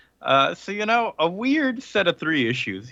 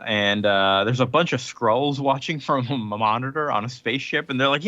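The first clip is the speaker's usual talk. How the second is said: normal talking voice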